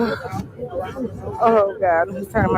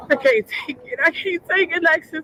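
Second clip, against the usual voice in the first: breaking down; 9 semitones higher than usual. Real, not acted. Relative overwhelmed while waiting for the identification, breaking down and fading out. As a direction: pitch climbing higher, fading to almost nothing at the end.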